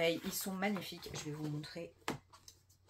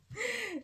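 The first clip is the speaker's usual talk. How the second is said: high-pitched burst of laughter; a short squeaky laugh at herself; sudden laugh. Real, not acted. Vlogger laughing at how much she overbought, high burst of laughter. Laughing at her book splurge.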